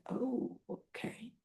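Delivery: very soft, slow retreat; acted backing down. Ted told not to spread her business, softly backing down. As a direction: back off softly and slowly. Man backs down after being stopped.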